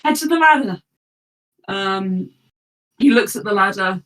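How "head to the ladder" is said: retold cry. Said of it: "Ulster's cry of".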